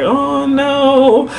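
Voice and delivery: high, drawn-out "oh no"; acted sympathetic groan; retold dismay. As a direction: stretch it high in mild dismay. Retold reaction to crying friend.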